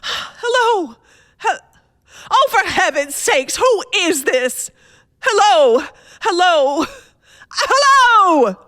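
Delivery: frightened wife shouts at the unknown caller; desperate hellos. Acted. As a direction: demand to know who it is, terrified.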